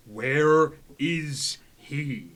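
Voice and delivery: loud, drawn-out pompous demand; booming demand. Acted. Knight demanding of the room where he is, booming. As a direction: call it out slow and loud across the room.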